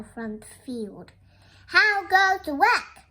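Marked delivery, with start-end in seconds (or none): 1.7-3.0 loud, raised-pitch farmer's call. Acted. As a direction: call out loudly to someone working. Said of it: "called the farmer".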